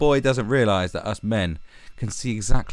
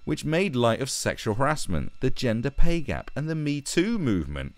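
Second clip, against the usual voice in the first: slightly higher, sing-song recital; mocking read-aloud; 6 semitones higher than usual. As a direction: read it as a self-important little boy. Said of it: "let's read what this little boy has to say".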